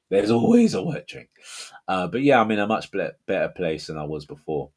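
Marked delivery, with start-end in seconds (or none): 0.1-1.3 high, mock-exasperated wail. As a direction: throw it up high in despair. Exasperated about endless work drinks.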